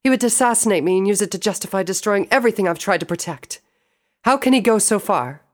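high, loud indignant sister voice; angry royal outburst, high and loud; outraged princess. Acted. Celestia rages about Gravitas.

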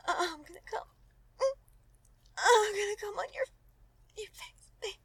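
loud high climax cry; climax moan. Acted. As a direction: let the climax break through.

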